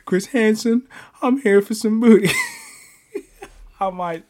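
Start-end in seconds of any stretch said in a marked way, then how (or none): 0.0-2.3 high, very loud cartoon quote; Boondocks character. Fan quoting a guy from Boondocks, high loud character impression. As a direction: belt out the cartoon line, pitched way up. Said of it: "and that guy said".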